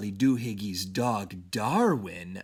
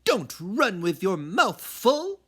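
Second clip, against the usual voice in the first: higher, louder scold; scolding mama; 8 semitones higher, 5 dB louder than usual. Acted. Mama scolding her son for running with food, loud and high. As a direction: warn him as he races by. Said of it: "hollered Mama".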